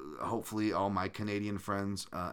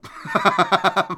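sudden loud laugh, much higher and louder; 11 semitones higher, 13 dB louder than usual. Real, not acted. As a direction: burst of real laughter, high and loud. Laughs at own accent.

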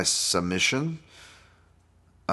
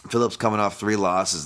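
normal talking voice

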